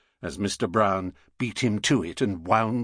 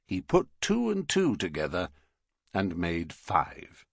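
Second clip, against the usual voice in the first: plain reading voice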